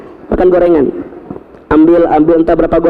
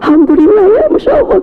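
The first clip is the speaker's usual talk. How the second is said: forced joy; 13 semitones higher than usual. Retold or imitated. A husband faking joy at wife's pregnancy news, high and tearful. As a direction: squeal thanks through a cracking voice, over the top. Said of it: "Saya bilang".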